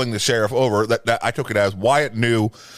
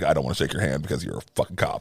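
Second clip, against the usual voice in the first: low, fast, dismissive retort; Doc Holliday; 7 semitones lower, 5 dB quieter, faster than usual. Retold or imitated. Doc refusing the sheriff's hand, quick low dismissal. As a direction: toss it off quickly and quietly. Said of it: "so that's why Doc's like".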